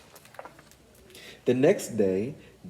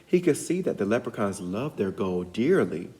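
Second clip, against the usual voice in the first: usual speaking voice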